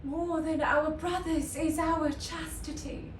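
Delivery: measured classical delivery with long pauses; resolute Shakespearean monologue. Acted. Isabel choosing chastity over her brother's life, resolute classical delivery. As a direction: start high and settle into grave resolve.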